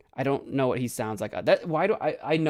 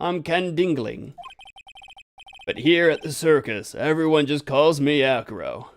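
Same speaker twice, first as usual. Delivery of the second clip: put-on voice, lightly done; measured introduction in a character voice; Acro; 3 dB louder than usual. Acrobat introducing himself at the circus, in a picked voice. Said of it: "I'm going with that one".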